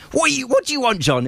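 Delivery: high, put-on mother's voice, testy; his mum at the door. Mum opening the door to her son at night, high and testy. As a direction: pitch way up like a disturbed mother. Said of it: "she goes".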